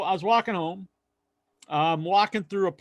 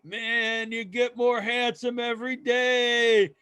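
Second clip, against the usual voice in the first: put-on drunk flattery; 7 semitones higher, slower than usual. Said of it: "then he goes".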